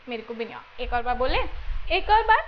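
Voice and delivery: normal talking voice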